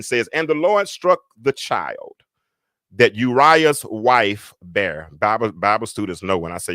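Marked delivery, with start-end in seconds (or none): 0.3-5.1 slow, drawn-out scripture reading; measured pulpit reading. Real, not acted. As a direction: read the verse out solemnly, word by word. Reads the verse aloud.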